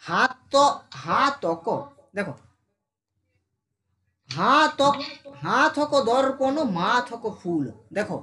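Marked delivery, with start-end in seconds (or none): 0.0-1.7 "হা" in "হাতক" stretched; drawn-out syllable. Shown as a demonstration. Teacher showing which part is stretched, "হা" drawn out. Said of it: "কোনটা কাট টানছি". 4.3-7.7 slow, rising long "হা" in "হাতক"; drawn-out syllables. Shown as a demonstration. Teacher showing the stretch, long vowels in "হাতক". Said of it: "টানছি এখানে".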